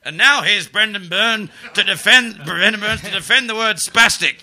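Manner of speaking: loud, high mock announcer intro; hammed-up breakfast TV host; TV presenter. Presenter introducing the comic on breakfast telly, mock announcer. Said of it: "And they're going".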